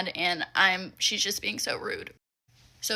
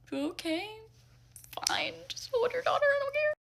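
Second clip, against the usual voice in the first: mock-sweet, much higher and softer brush-off; sarcastic giving up; 5 dB quieter than usual. Employee brushing off the stubborn mom, mock-sweet high. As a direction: toss it off in a high fake-nice voice.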